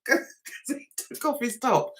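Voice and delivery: usual speaking voice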